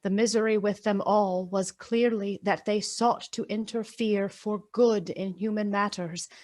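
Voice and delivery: ordinary delivery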